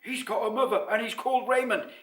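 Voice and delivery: high, loud amazed chatter; astonished crowd. A narrator's reading. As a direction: exclaim the news in amazement. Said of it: "Everyone was astonished".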